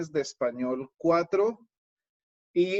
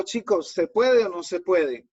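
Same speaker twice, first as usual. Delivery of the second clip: louder rousing call-out question; teacher rallying class; 3 semitones higher, 5 dB louder than usual. Shown as a demonstration. Teacher asking his students if it can be done, rallying. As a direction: fire up the class for a reply. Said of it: "Les digo".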